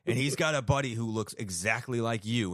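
normal talking voice

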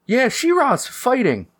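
put-on high toddler voice; bright three-year-old at play; little girl voice. Dana voiced during play.

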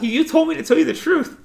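high, loud mock protest; indignant protest. Retold investigator's indignant protest.

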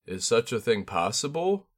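ordinary delivery, nothing marked

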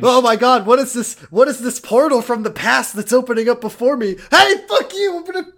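high, loud mock shout; mock outrage. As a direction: yell it in fake outrage.

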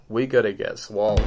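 ordinary talk

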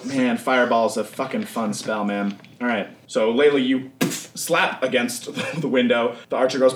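ordinary talk

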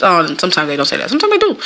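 ordinary talk, nothing marked

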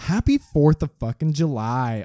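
high, drawn-out patriotic gushing; hammed-up national pride, climbing in pitch; mock patriotism. Celebrating the fourth of July, mock patriotic fervour.

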